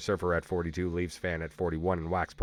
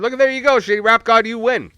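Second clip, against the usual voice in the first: loud, high, excited cheer; 12 dB louder than usual. Real, not acted. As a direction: celebrate the winner, big. Cheering on a winning player.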